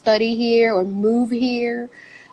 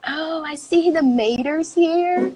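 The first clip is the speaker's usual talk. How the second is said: imitated Alabama coworker; 7 semitones higher than usual. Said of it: "he was like".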